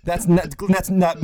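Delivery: ordinary delivery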